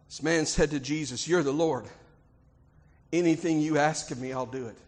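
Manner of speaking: low, hushed Bible narration; solemn storytelling. A narrator's reading. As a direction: tell it low and reverently. Hushed retelling of Bible dialogue.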